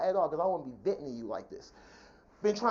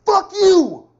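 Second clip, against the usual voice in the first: furious full-voiced shout, pitched way up; emotional outburst; 11 semitones higher, 16 dB louder than usual. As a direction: explode in a loud, high yell. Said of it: "you feel the emotion".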